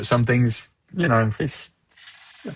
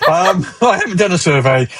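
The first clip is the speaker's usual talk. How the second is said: laughing; 6 semitones higher, 3 dB louder than usual. Real, not acted. Joking about having no survey data.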